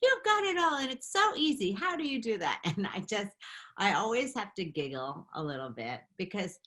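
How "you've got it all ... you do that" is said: high, excited gushing; gushing fan voice. Retold or imitated. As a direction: gush over her like a fan. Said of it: "They go".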